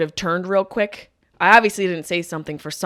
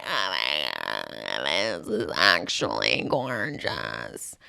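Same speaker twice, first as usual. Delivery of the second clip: much lower, very slow, drawn-out voice; deep slow mock awe; 8 dB quieter, much slower than usual. Comic savoring a gorgeous pastry, in a deep slow voice. Voicing herself tasting.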